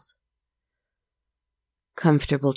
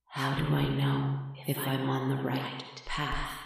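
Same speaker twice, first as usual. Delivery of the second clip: soft ghostly voice; 8 dB quieter than usual. Acted. Spirit's question voiced softly.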